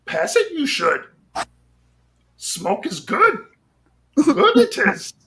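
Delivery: a piss-poor Yoda, slowly. Retold or imitated. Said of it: "that's a piss poor Yoda".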